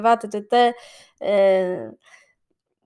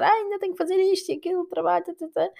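rushed inner thoughts; 6 semitones higher, 4 dB quieter, faster than usual. Retold or imitated. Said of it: "estou a pensar".

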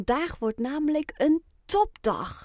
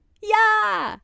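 much higher, loud cheer; shouted yes; 11 semitones higher, 7 dB louder than usual. A narrator's reading. The whole family shouts their answer to Oscar, loud and high. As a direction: yell it out together. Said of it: "roept de familie in koor".

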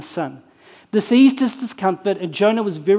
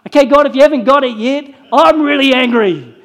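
louder, faster and higher, fed up; exasperated outburst; 5 semitones higher, 7 dB louder than usual. Retold or imitated. Preacher voicing Jonah to God, loud and exasperated.

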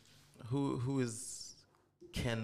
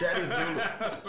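laughing mock kid answer; 8 semitones higher, 8 dB louder, slower than usual. Kid asked dad's name, joking.

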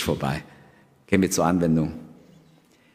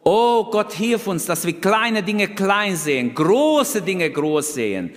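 much higher, louder fervent prayer; 10 semitones higher, 3 dB louder, slower than usual. Acted. The preacher praying over the congregation, fervent and loud. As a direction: cry out to God for help. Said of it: "closing prayer".

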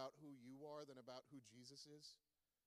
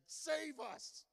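high, loud cry for rescue; desperate cry; 12 semitones higher, 10 dB louder than usual. Acted. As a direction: cry out like drowning men. Disciples cry out in storm.

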